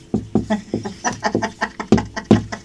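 breathy, voiceless giggling fit; helpless laughter in short puffs; long real laugh. Real, not acted. Teacher amused by her own naughty example, long breathy laugh.

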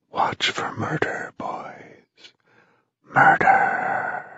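airless whispered warning; ghostly whisper. A narrator's reading. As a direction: hiss it from the dark. Ghost voice warns from tombs.

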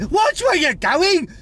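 angry shout. Acted. Shouting at other drivers.

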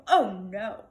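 loud exclamation of dismay; gasped dismay. A narrator's reading. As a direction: cry out in horror. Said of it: "gasped Bartholomew".

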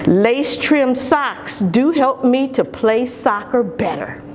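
ordinary delivery, nothing marked

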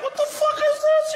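very high, loud yelp; hammed-up high-pitched freak-out; mock alarmed outburst. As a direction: shriek it like a panicked onlooker. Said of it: "be the guy to be like".

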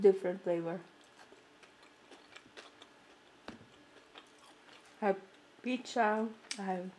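chewing between words; talking around food; mouth full. Real, not acted. Seen 0: Chewing jaw and pressed lips between words after biting the pizza.

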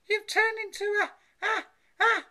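high, loud, stuttering mother; horrified stammering cry. A narrator's reading. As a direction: stammer as if building to a sneeze. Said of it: "she cried".

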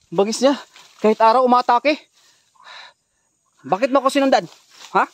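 loud and high, shouting at the creature; alarmed shouting. Real, not acted. Vlogger shouting at the aswang chasing him, alarmed and loud. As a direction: yell at it, scared but defiant.

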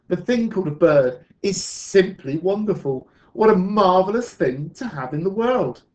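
loud, high, gushing admiration; thrilled storybook exclamation; excited praise. Acted. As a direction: marvel aloud with swooping pitch. Children praise Sue's bird.

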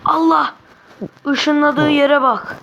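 stunned by where he teleported; loud amazed exclamation; shocked cry. Real, not acted.

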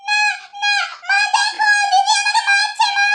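little sister howling to mother that she's being hit; tattling wail. Acted. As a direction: shriek for mother to rescue her.

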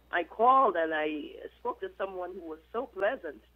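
ordinary talk

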